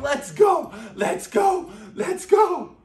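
high, loud let's-go shouts; eager shouting. Retold or imitated. Blind man eager to reach Jesus, high excited shouts. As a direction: fire him up, bursting to go. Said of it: "He is like".

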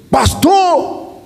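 high call of surprise across the street. Retold or imitated. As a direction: call out his title like an old friend. Stranger calls out to pastor.

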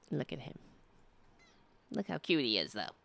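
tender murmured pet talk; soft cooing to pet. Real, not acted. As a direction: coo at the cat softly. Cooing softly at the cat.

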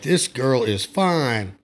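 drawn out slowly, word by word; slow demo phrase. Shown as a demonstration. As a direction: stretch it out to show the mouth shape. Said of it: "I wanna hear your fine hen mallard".

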